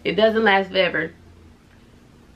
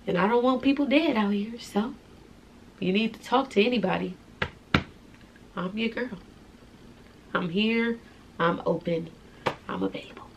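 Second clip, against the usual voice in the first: soft, reassuring; 8 dB quieter than usual. Real, not acted. Offering support to viewers.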